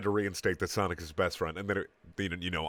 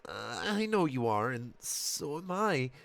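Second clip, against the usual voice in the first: mom voice; 6 semitones higher, 3 dB quieter, slower than usual. A narrator's reading. Mother voiced in story.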